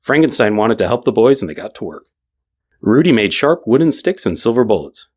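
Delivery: plain reading voice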